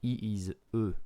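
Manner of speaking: E said the French way, as uh; E named as in French, uh; E as a French letter, uh. Shown as a demonstration. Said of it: "in French".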